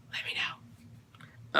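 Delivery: soft whispered aside; whispering. Real, not acted. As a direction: whisper it to the viewers.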